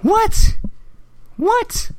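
baffled squeal of surprise; shocked disbelief. Retold or imitated. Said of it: "and I'm like".

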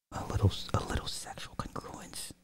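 teasing whisper. Pretend. Streamer teases chat about the next topic, whispered. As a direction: breathe it like a secret.

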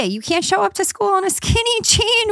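high-pitched, playful scolding; exaggerated fashion-police disbelief; mock outrage. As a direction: lay down the rule in a high, scandalized voice. Mock-outraged fashion rule for school.

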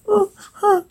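high, soft whimpers. Acted. Narrator acts Rex, high whimpering. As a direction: whimper in small high bursts. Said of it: "Rex whimpers".

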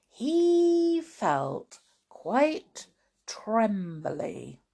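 slow, awed storytelling; dramatic, drawn-out ending of a children's tale; story narration. Acted. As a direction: linger on each phrase with hushed wonder at the end. Reads closing narration with wonder.

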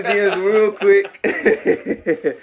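high, drawn-out amused delivery; laughing. Real, not acted. Podcaster joking about sliding into his co-host's DMs, laughing. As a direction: stretch it out high through laughter. Teasing about how they first got in touch.

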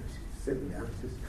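normal talking voice

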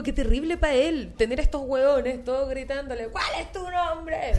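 put-on high, slurring drunk yell; town drunk. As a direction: play a drunk shouting the line. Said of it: "Todo borracho".

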